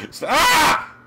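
word breaking into a high scream; frustrated scream. Real, not acted. Tree will not stay put.

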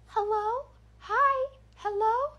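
put-on high, singsong little-girl calls; a horror doll circling and calling; creepy doll voice. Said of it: "that creepy doll voice".

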